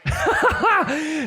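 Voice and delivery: high-pitched burst of laughter; amused high cackle; delighted laugh. Real, not acted. Laughs at the shot just shown.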